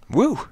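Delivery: short high "woo"; high giddy whoop. Acted. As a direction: let out a loopy late-night whoop. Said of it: "I'm just kinda like".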